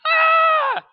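loud, high scream of fright; startled cry. Acted. Man startled by a shining angel, loud frightened cry. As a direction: let out a piercing, frightened yell. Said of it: "And he cried".

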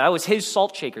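normal talking voice

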